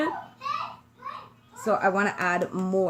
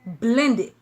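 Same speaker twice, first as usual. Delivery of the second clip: emphatic New York accent; louder New York-accented delivery; 2 semitones higher, 5 dB louder than usual. Real, not acted. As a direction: lean into the Brooklyn accent. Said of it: "My New York accent".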